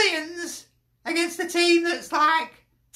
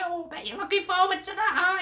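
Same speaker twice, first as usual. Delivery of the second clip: ordinary talk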